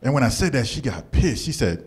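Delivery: usual speaking voice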